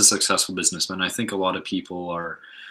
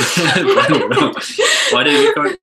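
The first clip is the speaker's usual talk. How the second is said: high-pitched laugh through the words; cracking up while reading; 8 dB louder, slower than usual. Real, not acted. Laughing fit, voice jumps high.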